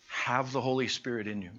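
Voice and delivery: usual speaking voice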